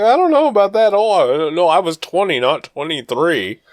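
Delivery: high, loud mock father starting to backtrack; light-footed dad. Dad hedging about dating age, imitated as high and light-footed. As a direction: pitch the dad up high, easing down by the end. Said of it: "he's a little light-footed".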